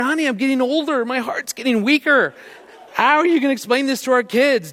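high-pitched comic protest to his wife; mock exasperation. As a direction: plead with her, half laughing at the story. Retold pleading scolding of prankster wife.

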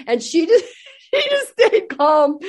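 real laughter breaking up the words; giggling through the telling; high laughing voice. Real, not acted. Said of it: "laughing while telling".

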